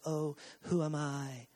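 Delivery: slow, low, very soft self-effacement; put-on meek little moan; mock humility. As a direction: imitate false modesty in a slow murmur. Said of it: "He's so humble".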